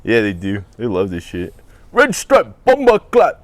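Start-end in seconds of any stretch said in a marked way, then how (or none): 1.9-3.4 put-on high Jamaican accent; hammed-up Jamaican patois. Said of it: "you can do Jamaican accent".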